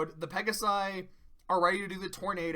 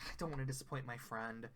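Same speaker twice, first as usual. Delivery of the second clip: soft timid Fluttershy; 7 semitones lower, 14 dB quieter, faster than usual. Retold or imitated. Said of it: "And Fluttershy's like".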